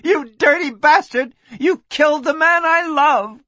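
high, loud outraged scream. A narrator's reading. Lucy May accuses the man who hit her lover, screaming. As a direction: scream the accusation at full pitch.

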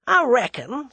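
lightly voiced tough American; menacing American gunman. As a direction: start a cool threat as the American.

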